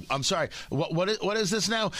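ordinary talk, nothing marked

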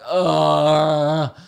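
long, loud groan of defeat; exasperated groan. Acted. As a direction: groan like a tiny hurdle ruined everything. Acts out frustration at small hurdle.

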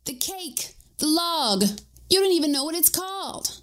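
high-pitched, exasperated retort; exasperated squabbling. Acted. Heated squabble over the cake.

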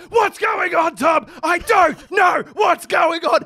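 high, loud panicked holler; re-enacted frantic shouting; screaming. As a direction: scream it out, stretching the words. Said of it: "I'm screaming".

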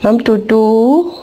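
ordinary talk, nothing marked

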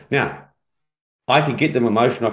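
ordinary talk

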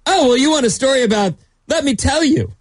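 high, fast Australian accent. Shown as a demonstration. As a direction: rattle it off in a broad Australian accent. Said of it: "That's my Australian accent".

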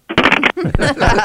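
real laugh after the prank call. Real, not acted. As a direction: burst out laughing.

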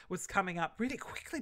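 usual speaking voice

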